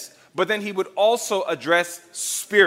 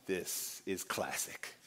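smug aside; 12 semitones lower, 16 dB quieter, slower than usual. Retold or imitated. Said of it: "they are like".

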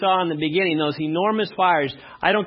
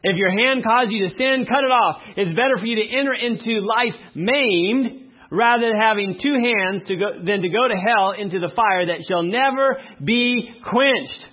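higher, louder fervent reading; preaching; 6 semitones higher, 4 dB louder than usual. Acted. Preacher declaiming the verse to the congregation.

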